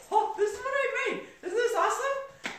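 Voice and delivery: high-pitched, laughing show-off squeal; gushing excitement. Retold or imitated. Showing the class what she made, excited.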